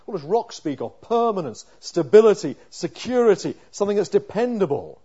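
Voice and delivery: loud, urgent sermon voice; pleading with God on the psalmist's behalf; fervent preaching. Acted. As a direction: preach it out with full force. Preaching verse nine with force.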